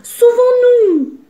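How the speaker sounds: terrified yell. A narrator's reading. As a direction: scream at the sight of the lion. Fleeing the lion in panic.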